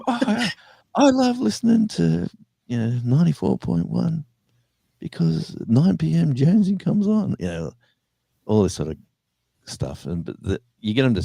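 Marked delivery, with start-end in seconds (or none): 0.1-7.4 very high gushing fan voice; excited fan reading a station promo; gushing caller. Retold or imitated. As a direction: gush like a caller reading a shout-out.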